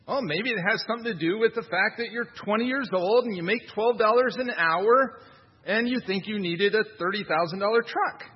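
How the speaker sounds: usual speaking voice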